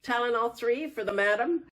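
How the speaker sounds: put-on high, fast, thrilled young man; squealing with delight; gushing fan. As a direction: gush like a star-struck fan. Doctor calls nurse eagerly.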